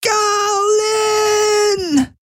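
very high panicked scream of Galen. Acted. Woman sealed in capsule screams, very high. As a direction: scream his name in panic. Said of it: "schrie sie".

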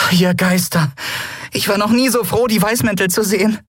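high-pitched voice of relief; relieved Nelen. A narrator's reading. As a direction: let the tension go in a high voice. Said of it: "Nelen stieß die angestaute Luft aus". (hohe, erleichterte Stimme)

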